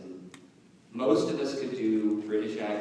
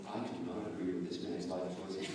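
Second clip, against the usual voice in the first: miserable; 4 semitones lower, 7 dB quieter, faster than usual. Retold or imitated. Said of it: "if I'm sounding miserable".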